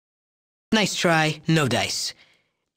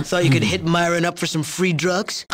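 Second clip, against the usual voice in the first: ordinary talk, nothing marked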